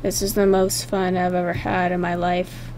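low, very soft monotone; quiet deadpan. Acted. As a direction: drop it low and hushed, without enthusiasm. Redoes line more deadpan.

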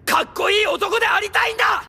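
heroic shout. Acted. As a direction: shout it like a hero. Hero's declaration to his wife. (高く力強い叫び)

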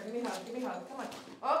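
ordinary talk, nothing marked

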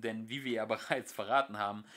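ordinary delivery, nothing marked

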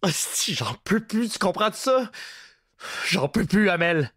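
on the verge of tears. A narrator's reading. Terrified prisoner begs Bruno to stop, wailing. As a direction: beg for your life near tears. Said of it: "au bord des larmes, il gémit".

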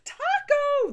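very high, very loud shrieking call; screeching shout. Acted. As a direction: screech the dog's name. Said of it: "the woman shouted".

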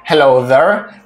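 ordinary talk, nothing marked

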